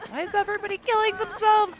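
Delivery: high, loud exasperated cry; can't believe what the team is doing; exasperated disbelief. Real, not acted. Teammates dying, exasperated.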